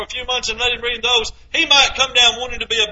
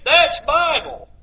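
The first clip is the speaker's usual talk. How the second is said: normal talking voice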